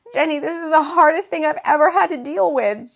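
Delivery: loud, high and upset, a touch of tears; sobbing. Acted. Jade telling Jenny it is the hardest thing, a touch of sobbing. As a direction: let it spill out, barely holding together. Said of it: "Jade was sobbing again".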